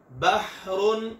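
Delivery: बहरुन with a rough ha rubbed from mid-throat; Arabic ha from the middle of the throat; throaty ha. Shown as a demonstration. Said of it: "हलक के दरमियान से रगड़ खाकर".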